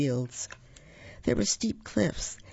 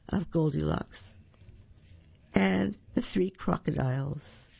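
usual speaking voice

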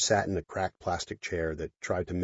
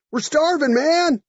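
shouting; 8 dB louder, slower than usual. Acted. Hungry drunk crowd calling.

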